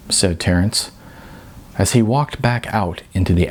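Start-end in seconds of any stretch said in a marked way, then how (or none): none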